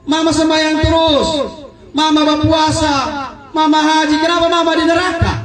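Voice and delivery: put-on a child begging to know why; pleading daughter. Daughter asks her mother in hell why, high and pleading.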